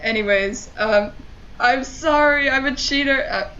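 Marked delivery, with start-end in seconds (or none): 1.6-3.3 raised, louder mock-contrite cry; mock apology. Real, not acted. Player confessing to viewers about cheating, mock apology. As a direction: call out a playful apology.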